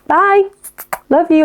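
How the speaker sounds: playful high farewell; high sing-song goodbye. Retold or imitated. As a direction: chirp the goodbye. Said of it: "So you're like".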